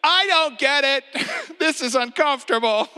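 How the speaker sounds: high, loud, laughing mock panic; comic high-pitched Peter protesting; flustered Peter. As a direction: squeak it out like a nervous disciple. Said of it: "Peter's like".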